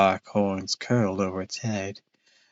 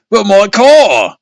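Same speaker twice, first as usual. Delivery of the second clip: shriek; 11 semitones higher, 12 dB louder, slower than usual. Acted. Dad shrieking continues.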